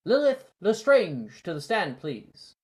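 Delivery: high, loud summons; announcer call. Acted. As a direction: call her to the stand for all to hear. Announcing next duellist.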